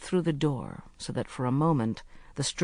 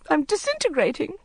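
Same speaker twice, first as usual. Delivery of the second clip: higher, plaintive cry; lightly hammed-up lament; mild wail; 13 semitones higher than usual. A narrator's reading. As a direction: wail it, half-hearted. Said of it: "she wailed".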